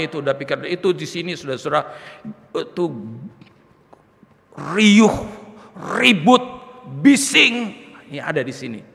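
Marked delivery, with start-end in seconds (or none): 4.7-7.8 loud, high bursts with pauses between; forceful outburst. Acted. Preacher showing the turmoil inside him, forceful outburst. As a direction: punch out each word loudly on its own. Acting out noise inside his head.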